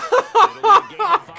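very high laughing sob of despair; laugh-cry. Real, not acted. Laughing-crying after the failure.

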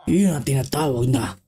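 deep villager voice. Acted. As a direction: speak as a tiny villager with a booming low voice.

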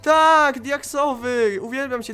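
high, loud burst of joy; jubilant. Real, not acted. Player finding a diamond pickaxe, jubilant cheering. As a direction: cheer loudly, then gush.

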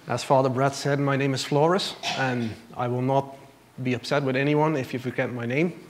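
ordinary talk

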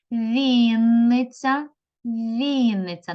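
Вінниця with the first і stretched long; stress on first syllable. Shown as a demonstration. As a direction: call out Вінниця slowly, leaning on Він. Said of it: "поставимо в цих словах наголос".

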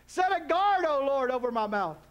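loud, high proclamation; preaching the verse at full volume; proclaimed verse. Acted.

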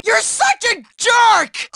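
slow, high indignant yell; anguished outburst. Acted. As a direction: drag out the insult in outrage. Nerd protesting to his bully.

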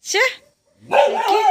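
sharp high call of the dog's name; calling out sharply. Real, not acted. Owner calling out to her dog, high and loud. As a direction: snap the name to stop the dog.

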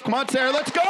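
high, loud shout of encouragement; cheering shout. Real, not acted. Coach hyping Sarah through her lift, shouting. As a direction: yell her up the bar.

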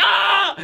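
high mock shriek of panic; high panicked yelp. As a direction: let out a high freaked-out yell. Momonosuke freaking out.

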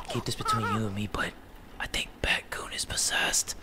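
hushed, secretive whisper; soft unvoiced aside; confiding whisper. Secret shared about the doll.

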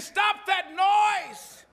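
high, loud, very slow stop that noise; acted stern order. Pharisees ordering Jesus to hush the crowd, high slow shout. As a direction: demand silence from the crowd.

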